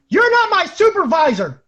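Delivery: put-on high, loud drunk defiance; defiant yell. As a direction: yell it straight in her face. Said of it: "I looked her in the face and I said".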